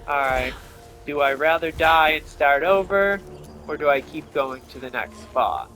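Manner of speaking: usual speaking voice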